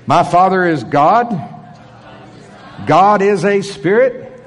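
slow, emphatic repeat-after-me declaration; firm, measured preacher's affirmation; leading a declaration. Real, not acted. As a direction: proclaim it in short phrases for a congregation to echo. Leads congregation in repeat-after-me declaration.

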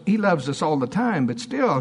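ordinary delivery

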